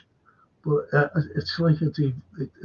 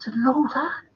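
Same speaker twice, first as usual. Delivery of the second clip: mock surprise; 9 semitones higher than usual. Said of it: "You know".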